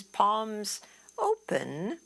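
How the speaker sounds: usual speaking voice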